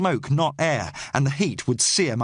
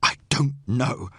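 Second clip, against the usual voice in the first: slow, hoarse, struggling answer; raw-throated, effortful reply; smoke-choked; 2 semitones lower than usual. A narrator's reading. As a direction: labor it out before a cough. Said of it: "coughing again".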